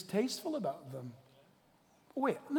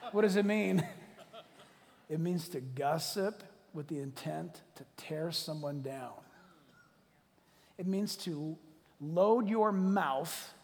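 normal talking voice

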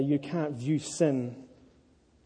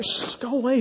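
high hushed brush-off; mock-irritated shooing aside; dismissive shooing; 8 semitones higher than usual. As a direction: shush and shoo a pestering dog. Shooing his real dog away.

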